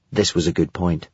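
ordinary delivery, nothing marked

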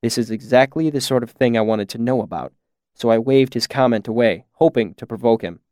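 usual speaking voice

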